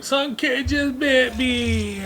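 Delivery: high, drawn-out mock wail; imitated crying child. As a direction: wail it slowly like a tearful kid. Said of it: "my niece comes crying".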